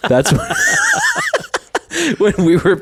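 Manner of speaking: cracking up mid-sentence; real laughter breaking through; laughing. Real, not acted. Laughing at mom's phrase.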